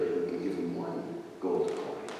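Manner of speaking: ordinary delivery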